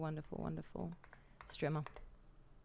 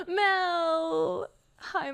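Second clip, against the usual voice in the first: excited cry; 9 semitones higher, 7 dB louder than usual. Real, not acted. Excited greeting to chatter Mel.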